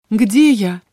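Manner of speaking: plain reading voice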